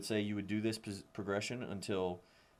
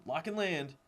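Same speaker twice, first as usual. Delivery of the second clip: Lock and land called high; called cue; 7 semitones higher than usual. Shown as a demonstration. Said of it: "So you just tell 'em".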